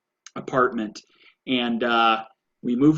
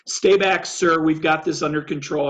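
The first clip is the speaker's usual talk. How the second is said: raised, higher-pitched officer's command; police officer; 4 semitones higher, 4 dB louder than usual. Retold or imitated. Officer warning off a bystander, loud commanding voice. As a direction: imitate an officer ordering someone back, loud then easing. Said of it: "officer who was like".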